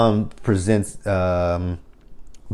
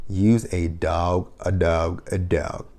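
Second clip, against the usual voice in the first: drawn out, fading softer; slow fading insult; 3 semitones lower, 5 dB quieter, much slower than usual. Acted. Vlogger slowly calling Marcus a dog, fading. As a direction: let each word drop lower and slower.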